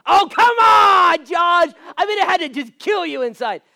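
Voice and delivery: high, shouted disbelief; mock protest. Congregant disbelieving the pastor wasn't bothered, loud mock protest. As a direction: shout it in exaggerated exasperation.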